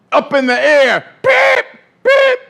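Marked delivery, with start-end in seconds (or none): 1.2-2.4 high-pitched referee whistle beeps; imitated whistle. Preacher mimics disciples as referees, high whistle beeps. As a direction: mimic sharp whistle blasts with the voice. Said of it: "They start throwing flags".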